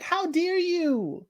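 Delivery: mock outrage at the nurse; a light imitation of an offended relative; indignant. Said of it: "It's like".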